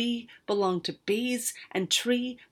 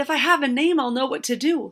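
desperate plea; 5 semitones higher, 6 dB louder, faster than usual. A narrator's reading. Girl keeps pleading.

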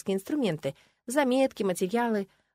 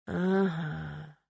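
long, understated, noncommittal reply; laconic assent; 3 semitones lower, 4 dB quieter than usual. A narrator's reading. Necromancer answers about the pillows, laconic and drawn-out. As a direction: draw out a minimal answer.

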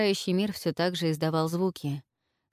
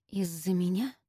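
softer, deflated echo of the words; sinking voice; 4 dB quieter than usual. A narrator's reading. Olga repeats his words to Zhelan, voice sinking. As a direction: let the voice drop, crestfallen. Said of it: "повторила Ольга упавшим голосом".

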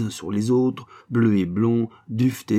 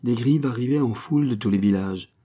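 ordinary delivery

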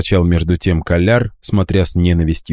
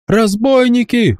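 high, slow alarm cry; shouting; slower than usual. A narrator's reading. Boy still crying robbers to the house, high yell. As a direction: keep screaming for help. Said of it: "продолжал кричать Рокамболь".

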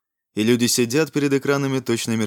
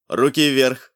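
metallic robot command; 2 semitones higher, slower than usual. A narrator's reading. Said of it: "Металлический голос приказал".

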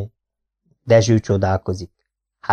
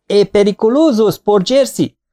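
much higher, louder roar; full-throated bellow; 11 semitones higher, 4 dB louder than usual. Acted. Ali bellows at the waiter's face, full-throated roar. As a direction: bellow it right in his face. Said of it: "teli torokból bömböli a képébe".

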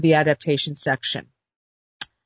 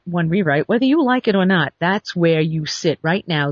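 ordinary delivery

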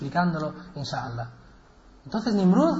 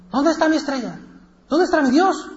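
high-pitched, urgent calling; acted cry out; 10 semitones higher, 4 dB louder than usual. Ibrahim looks for his vanished star, crying out high. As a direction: call out in mock alarm, pitched high. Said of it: "Entonces Ibrahim gritó".

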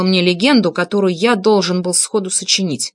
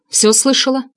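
higher, pointed question; challenging; 6 semitones higher than usual. A narrator's reading. Narrator asking Vika if she overheard, challenging. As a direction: confront her with a dare. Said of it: "спросил я с вызовом".

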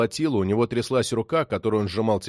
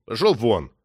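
gruff, sullen dismissal; surly brush-off; gloomy grunt. A narrator's reading. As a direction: grumble him out of the room. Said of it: "хмуро буркнул".

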